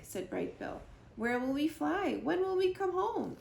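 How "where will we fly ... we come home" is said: high, eager gosling questions; rising little-bird curiosity; gosling asks about the journey, high and eager; curious gosling. Acted.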